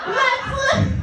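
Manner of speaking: very high-pitched, drawn-out battle cry; rally the dying in a high, stretched cry; hammed-up rallying cry. Nurse turning up oxygen to keep patients alive, mock rallying.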